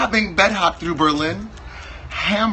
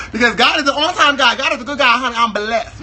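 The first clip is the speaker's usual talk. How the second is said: higher and louder, like church praise; preacher praise; 7 semitones higher, 4 dB louder than usual. Acted. Preacher son mocking church, shouts praise like a preacher. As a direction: testify it to the congregation.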